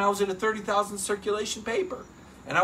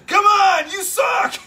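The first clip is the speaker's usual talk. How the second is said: much higher, louder mock shout; yelling spotter; 13 semitones higher, 8 dB louder, slower than usual. Spotter yelling down at a lifter, loud mock shout. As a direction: yell it down at the lifter on the bench. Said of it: "the guy's yelling down at you".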